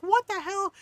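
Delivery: high, loud mock outrage; imitating scolding mom. Kid's mom catching him, imitated outrage. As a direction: pitch it up, scolding. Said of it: "She's like".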